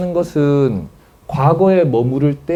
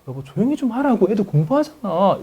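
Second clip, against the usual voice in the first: high, restrained nagging; mock scolding hush; 5 semitones higher than usual. Wife hushes husband while kids study, mock nagging. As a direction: hush your spouse with a touch of irritation. Acted spouse hushing the caller.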